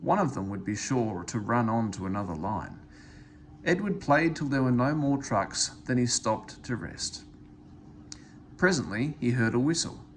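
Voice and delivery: plain reading voice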